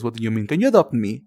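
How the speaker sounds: ordinary delivery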